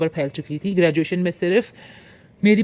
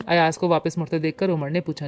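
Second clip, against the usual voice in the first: ordinary delivery, nothing marked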